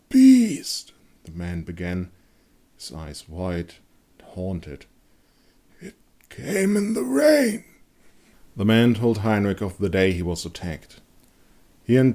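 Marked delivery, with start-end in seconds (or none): 0.1-0.9 much higher, louder fearful word; haunted wounded man. A narrator's reading. 5.8-7.6 much higher feverish fearful voice; haunted wounded man. A narrator's reading. Wounded man tells attack.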